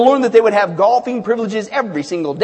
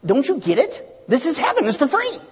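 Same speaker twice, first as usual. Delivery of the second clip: acted impatience, rising higher; a little exasperated; 6 semitones higher than usual. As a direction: pitch climbing in mild exasperation. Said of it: "Peter's getting a little exasperated at this point".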